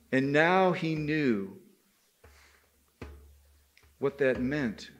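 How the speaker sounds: plain reading voice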